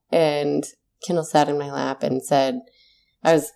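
normal talking voice

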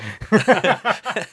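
high burst of laughter; bright loud laugh. Real, not acted. Laugh at joke.

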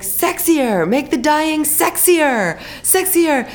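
voice director's note. Retold or imitated. The voice director coaching her, high and slow, hammed-up sexy. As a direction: ham up the director begging for sexier. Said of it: "But he's like".

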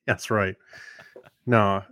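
usual speaking voice

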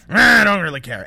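loud, dismissive "nah" to start; Michael Hayes, mock indifference. Director who doesn't care about the story, mock indifference. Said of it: "Michael Hayes is just like".

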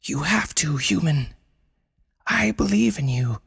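tender high whisper; gentle encouragement. Acted. Panda encourages Brenda.

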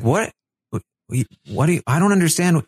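fast, high, stammering bafflement; mock confusion. [name] fails to follow Paloma, confused. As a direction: imitate someone flustered and lost.

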